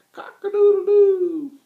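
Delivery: very high, very loud, slow crow; full-throated rooster crow. A narrator's reading. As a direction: show him how it's done. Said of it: "Now listen to me".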